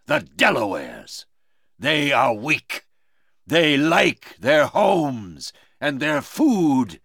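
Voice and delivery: very slow, emphatic villain's voice; scornful villain. Acted. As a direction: taunt slowly, drawing out the words. Villain Magua scorns Uncas.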